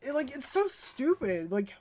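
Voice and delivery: ordinary talk, nothing marked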